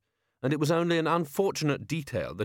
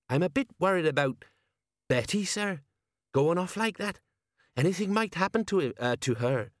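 higher, halting concern; hesitant worry; 7 semitones higher than usual. A narrator's reading. Colon worried about Betty to the patrician, hesitant. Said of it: "he spoke with some hesitation".

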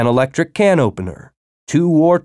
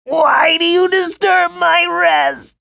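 angry worm; slower than usual. A narrator's reading. Wounded worm complains.

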